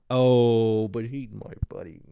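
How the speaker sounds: doting pet-owner voice; affectionate cooing. Real, not acted. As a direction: gush lovingly over your dog.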